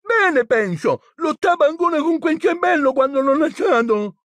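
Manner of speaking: put-on very high, strained boy; high-pitched strained reply; Neville's voice. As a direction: answer in a high, stuffed-up boy's voice. Injured Neville answers in battle.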